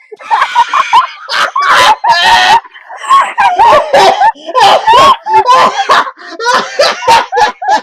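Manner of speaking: shrieking, helpless laughter; loud laughing fit. Real, not acted. Friend laughing hard at the radio story. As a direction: lose it completely, crying with laughter.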